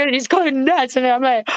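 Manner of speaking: ordinary talk, nothing marked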